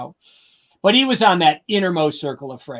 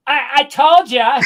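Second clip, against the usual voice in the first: higher and louder I-told-you-so; gloating; 11 semitones higher, 6 dB louder than usual. Retold or imitated. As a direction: rub it in, triumphant. Road closure, Freddie gloats.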